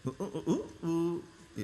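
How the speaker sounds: soft hooting imitation of a phone's whistle tone; whistling message tone. Shown as a demonstration. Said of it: "city વાગતી હોય એવો મેસેજનો tone".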